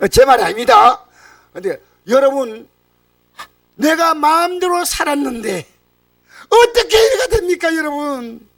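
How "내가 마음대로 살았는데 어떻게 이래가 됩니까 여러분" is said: high, crying, slowed lament of a ruined sinner; choked-up high-pitched cry; tearful lament. Acted. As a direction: wail it out like a man who wasted his life. Sinner lamenting own life.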